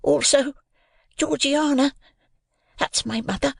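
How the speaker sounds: timid. A narrator's reading. Pip explains the gravestone to the convict, timidly. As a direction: explain timidly in a small high voice. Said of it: "I timidly explained".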